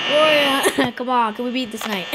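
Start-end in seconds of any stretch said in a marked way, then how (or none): none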